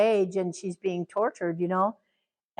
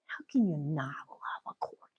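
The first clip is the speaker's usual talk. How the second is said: doting whisper; 4 semitones lower, 8 dB quieter than usual. Real, not acted. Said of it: "doting on her dog".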